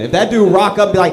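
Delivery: fast, loud, laughing; loud excited setup. Real, not acted. Setting up Hitler voice.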